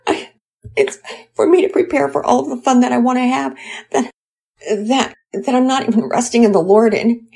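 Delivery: high, upset voice of a crying mother; tearful confession. Acted. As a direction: pour out the shame between sobs. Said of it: "Mommy Peters let out a long sigh".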